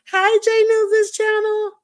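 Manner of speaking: high falsetto hello; squeaky high greeting. Pretend. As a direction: greet them in a tiny high voice. Greets channel in silly voice.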